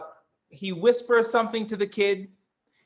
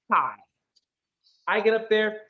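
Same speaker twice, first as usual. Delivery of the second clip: normal talking voice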